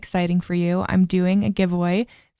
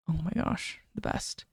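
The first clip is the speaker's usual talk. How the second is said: a hushed, near-whispered sigh of delight; dreamy whisper; 4 semitones lower, 12 dB quieter than usual. Real, not acted. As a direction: breathe it out as if savouring the moment. Savouring the thought of mornings.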